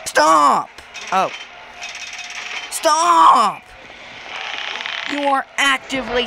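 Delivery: loud, high shout of stop; frantic shout. Real, not acted. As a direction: yell at them to stop. Yelling at teammate.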